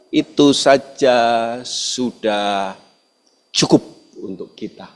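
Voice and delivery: preacher lingering softly on the key line; soft sermon emphasis. Acted. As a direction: let the truth sink in slowly.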